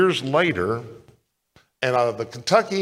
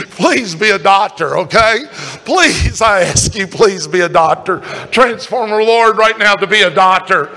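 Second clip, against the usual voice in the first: loud, higher, laughing plea; mock pleading prayer; 5 semitones higher, 7 dB louder than usual. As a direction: beg God half in jest. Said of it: "mock prayer pleading".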